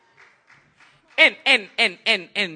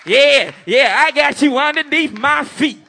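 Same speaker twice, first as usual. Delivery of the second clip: smug mock believers; 7 semitones higher, 11 dB louder than usual. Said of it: "some folks think".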